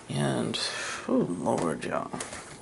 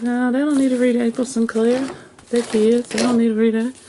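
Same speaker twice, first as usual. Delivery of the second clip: higher, louder retold objection; mock indignant protest; 6 semitones higher, 4 dB louder than usual. Retelling why she took books back, mock indignation. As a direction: protest emphatically, playing up your own reaction. Acts out her own protest.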